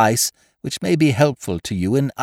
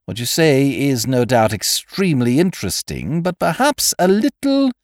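cold and even; 12 semitones higher, 5 dB louder than usual. A narrator's reading. Headmistress cutting Wooster off, cold and even. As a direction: cut him off, cold and level. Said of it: "she said in a cold, even voice".

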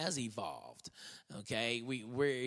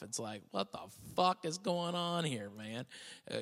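usual speaking voice

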